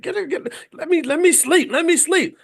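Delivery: high, loud groggy growl; growling half-asleep to be left alone; sleepy growl. Retold or imitated. Wife imitating the sleeper's groggy growl. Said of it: "you started growling".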